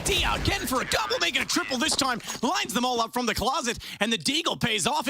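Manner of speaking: high, excited play-by-play call; esports caster. Real, not acted. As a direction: call the multi-kill with rising hype. Double kill called live.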